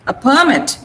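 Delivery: permit with PER stressed, mit light; noun stress. Shown as a demonstration. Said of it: "You are stressing mit here".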